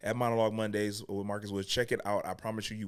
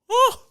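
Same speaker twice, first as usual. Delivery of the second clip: high, loud mock-shocked "oh"; playful startled whoop; 11 dB louder than usual. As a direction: let out a sudden high yelp. Reacts to stalking celebrity question.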